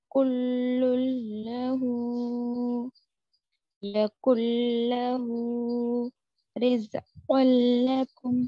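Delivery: slow drawn words, each l joined on; careful Quranic reading; Tajweed examples. Shown as a demonstration. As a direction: recite the examples slowly with no hum. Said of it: "यहाँ पर उफ़्फिन नहीं".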